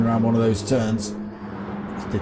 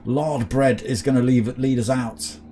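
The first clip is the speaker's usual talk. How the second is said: motorsport commentator; 3 semitones higher than usual. Acted. Live sim race commentary.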